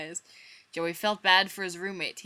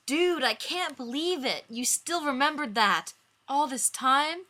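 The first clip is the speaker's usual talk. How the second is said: amazed; 8 semitones higher, 5 dB louder than usual. Acted. Joey voiced, amazed.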